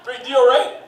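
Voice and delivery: put-on game store clerk hard-selling a kid; pushy salesman. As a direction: sell it fast and eager. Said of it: "people at the game store who try to sell".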